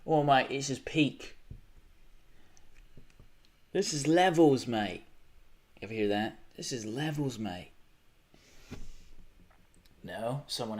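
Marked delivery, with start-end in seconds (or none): 0.1-5.1 hammed-up British accent, slow at the start; a joking British impression; mock British accent. Mocking streamers with a British accent. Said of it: "kind of like a British thing". 6.6-7.7 put-on British accent. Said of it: "kind of like a British thing".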